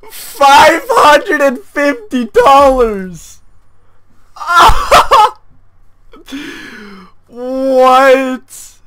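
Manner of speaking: real overjoyed yelling, slow and stretched; ecstatic shouting. Real, not acted. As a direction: yell it like you can't believe your luck. Sees the knife is worth 550 dollars.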